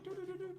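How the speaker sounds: very high little beeped doos; imitated phone dialing. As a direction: mimic phone keys with your voice.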